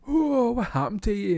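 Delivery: high, loud mock gasp of surprise; mock shocked coworkers. Coworkers quoted reacting to shaved head.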